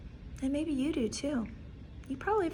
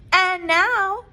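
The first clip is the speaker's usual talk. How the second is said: higher, louder proclamation; cried out; 7 semitones higher, 12 dB louder than usual. Acted. Max cries out to the wild things. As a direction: call it out to the monsters. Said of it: "cried Max".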